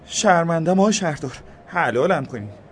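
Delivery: a shaken, high-voiced worker. A narrator's reading. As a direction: stammer out an apology. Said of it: "حسابی جا خورده بود".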